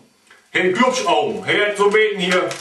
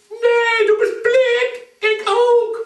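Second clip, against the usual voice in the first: very high squeak, drawn out on blind; squeaky snake insisting; 14 semitones higher, much slower than usual. Acted. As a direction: shrink to a tiny squeaky snake.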